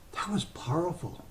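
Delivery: hushed whisper. Real, not acted. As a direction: breathe it out as a hushed aside. Recalling being in Iraq on 9/11.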